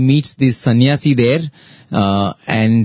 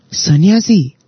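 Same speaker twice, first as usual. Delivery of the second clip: raised, lively lecturing king voice; king scolding; 7 semitones higher than usual. Retold or imitated. King chiding the weeping messenger, raised and emphatic.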